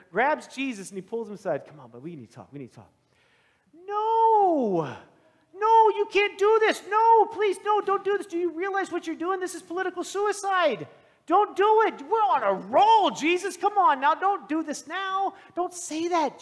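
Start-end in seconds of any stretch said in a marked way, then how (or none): 1.6-2.9 hushed aside. Acted. Peter pulls Jesus aside. 3.9-16.4 high, loud, desperate begging; pleading Peter. Acted.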